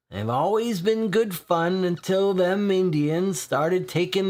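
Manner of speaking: a dry regular perking up over a free pint; lightly voiced weary old drinker; thirsty old drinker.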